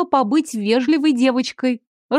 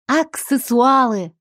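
happy creature voice; 2 semitones higher, slower than usual. A narrator's reading. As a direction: say it gleefully like a little creature. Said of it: "радостно кивнул".

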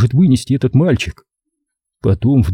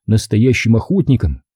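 ordinary delivery

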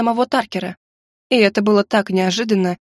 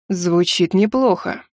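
slow, low ominous agreement; sinister; 3 semitones lower, slower than usual. A narrator's reading. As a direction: let it hang like a threat. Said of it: "зловеще согласился Таркер".

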